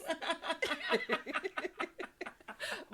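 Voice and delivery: high laugh. Real, not acted. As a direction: laugh at the singing. Laughing at the singing.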